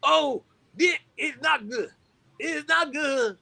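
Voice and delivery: high, mournful soul-singer voice; hammed-up high-pitched complaint. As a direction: lament in a high celebrity impersonation.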